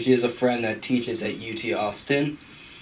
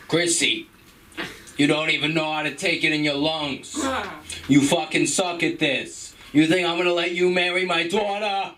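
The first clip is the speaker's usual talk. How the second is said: lightly voiced New York mobster, higher and scolding; Tony Soprano impression; 6 semitones higher than usual. Tony berates Chrissy over his bad weed, mild mobster voice. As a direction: chew out Chrissy like a Jersey boss. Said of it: "Let me do Tony Soprano".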